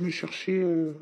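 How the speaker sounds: usual speaking voice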